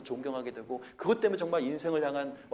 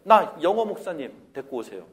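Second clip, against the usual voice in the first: put-on defiant teen; 10 dB louder than usual. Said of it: "혼내려고 그러면".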